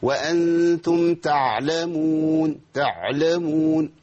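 slow chanted تعلمون with an effortful ع; faulty recitation, struggling through the ع of تعلمون; laboured ayn. Shown as a demonstration. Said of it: "دي صعبة جدًا".

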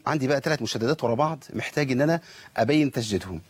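ordinary delivery

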